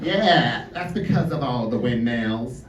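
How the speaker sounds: low mock American accent; American tourist. American tourist explaining the wind to a friend, mock American accent. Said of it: "overheard these two American tourists".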